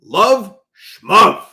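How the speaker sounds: loud, high imitation of a scornful outburst; punchy reenacted shout; retold yell. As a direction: yell it dismissively, imitating the guy.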